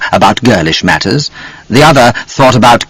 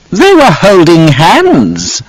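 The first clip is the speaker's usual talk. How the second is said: gloating; 8 semitones higher, much slower than usual. A narrator's reading. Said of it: "she smiled broadly".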